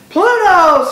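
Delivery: very high, very loud scream; screamed cheer. A narrator's reading. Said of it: "screamed the underdogs".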